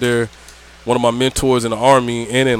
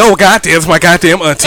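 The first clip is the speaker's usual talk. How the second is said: shocked realization; 4 semitones higher, 5 dB louder than usual. Retold or imitated.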